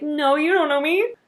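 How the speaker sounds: teasing protest to husband, then admitting it; playful denial. Retold or imitated. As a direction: pretend to be offended, then own it. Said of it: "And I'm like".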